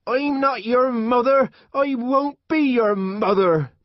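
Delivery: high, loud furious rejection; furious shout. A narrator's reading. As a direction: scream it at the child in rage. Mother raging at slapped child.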